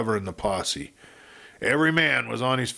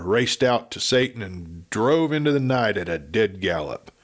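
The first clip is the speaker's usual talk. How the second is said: usual speaking voice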